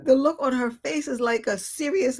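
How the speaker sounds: ordinary talk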